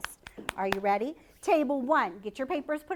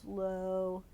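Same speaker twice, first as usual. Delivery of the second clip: slow like a tortoise; drawn-out slow. Shown as a demonstration. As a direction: stretch "So slow" out very slowly. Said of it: "remember how a tortoise likes to walk".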